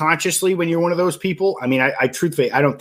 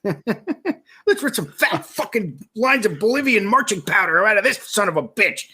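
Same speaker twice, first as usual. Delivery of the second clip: mock Gary Vaynerchuk, laughing; 6 semitones higher than usual. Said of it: "Gary Vaynerchuk's like".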